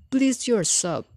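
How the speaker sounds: high squeaky unbothered pig; cheeky little pig. A narrator's reading. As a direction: toss it off with a squeak. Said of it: "called the third little pig".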